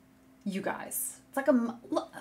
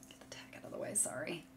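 whispered aside; 18 dB quieter, faster than usual. Real, not acted. Fussing with tag on camera.

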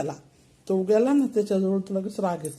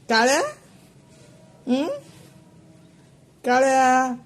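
louder calling out to the cats; 5 semitones higher, 4 dB louder than usual. Real, not acted. Owner calling her two cats, louder calling voice. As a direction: call the cats' names across the room.

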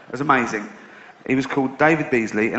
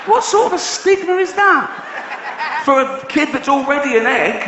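outraged disbelief; 10 semitones higher than usual. Real, not acted. Comedian mocking the Dumpty parents, incredulous high shout. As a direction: squeal it in mock outrage.

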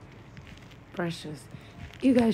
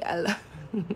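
usual speaking voice

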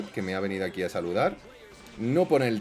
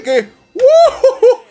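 high whoop; 16 dB louder than usual. Real, not acted. Big reaction.

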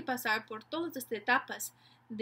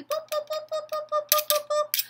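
high, rapid string of pops; pop-pop-pop in a high toy-noise voice; playful popping sound; 5 dB louder than usual. Shown as a demonstration. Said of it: "algo chistoso relacionado con una acción". (sonido chistoso de pop, agudo y rápido)

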